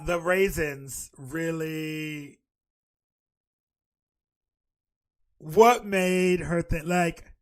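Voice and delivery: very slow, loud and incredulous; slow disbelief. Real, not acted. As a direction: drag out the disbelief. Disbelieving rant.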